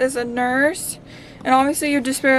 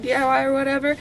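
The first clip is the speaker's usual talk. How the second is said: ordinary talk, nothing marked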